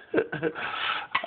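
breathy quiet laugh; quiet real chuckle; laughing under the breath; soft laugh. Real, not acted. Laughing at drunk dog.

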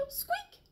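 a shy little squeak; mouse squeak. Acted. Mouse squeaking nervously at the lion, tiny high squeak.

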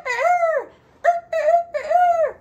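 high, loud rooster cry; rooster crow. Pretend. Teacher crows like a rooster for children. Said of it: "Let's do rooster".

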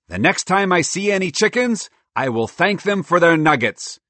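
high, loud squirrel declaration; gleeful cartoon squirrel, loud; excitable squirrel, grateful. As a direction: proclaim your delight, pitched high.

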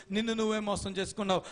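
normal talking voice

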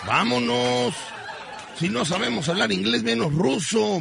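agudo, asustado y apurado; voz de Rucho asustado. Retold or imitated. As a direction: Rucho con miedo, muy agudo. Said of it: "me dijo". (Rucho pide irse del restaurante ruso, agudo y nervioso)

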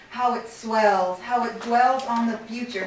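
ordinary delivery, nothing marked

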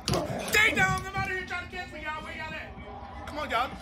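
normal talking voice

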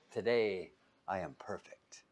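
playful hushed self-praise; mock whisper. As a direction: whisper it like a secret brag. Mocking the perfect person.

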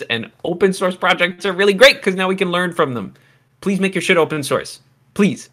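high, loud pleading; earnest plea. Real, not acted. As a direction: beg the audience earnestly. Begging viewers to share code.

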